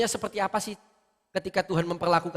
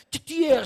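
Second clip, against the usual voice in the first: higher, louder imitated whack; higher mock thwack noise; 10 semitones higher, 4 dB louder than usual. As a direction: make the second smack land harder and higher. Mimicking blows from God. (bunyi pukulan tiruan lebih tinggi dan keras)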